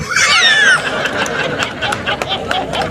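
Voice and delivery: breathy, high burst of laughter. Real, not acted. Preacher bursts out laughing at his story. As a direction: laugh out at the memory.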